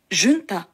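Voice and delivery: ジュンタ as "JUN-ta", with a J and "oo"; variant pronunciation. Shown as a demonstration. Teacher listing other pronunciations, ジュンタ with a J sound. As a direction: say ジュンタ with a J and an "oo" vowel. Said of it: "と発音する人もいます".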